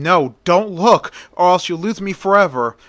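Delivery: urgent warning. A narrator's reading. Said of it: "Eurydice warned him".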